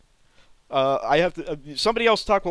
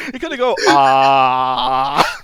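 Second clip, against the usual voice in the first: mock robot ah; 9 dB louder, much slower than usual. Imitating the robot's "Ah.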